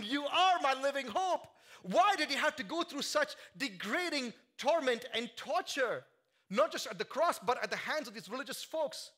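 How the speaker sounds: loud, high, impassioned sermon; full force preaching. Acted. Preacher to his congregation, shouting with fervor. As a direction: lift the room with rising fervor.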